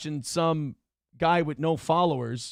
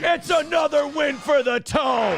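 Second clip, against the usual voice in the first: victory shout; 9 semitones higher than usual. Real, not acted. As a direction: shout the catchphrase like a champion.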